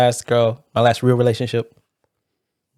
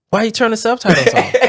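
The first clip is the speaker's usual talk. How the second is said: raised-pitch mock accusation; retold indignant question; imitating annoyed girlfriend; 8 semitones higher than usual. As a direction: demand it like a put-out girlfriend. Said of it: "She was, like, really mad about it".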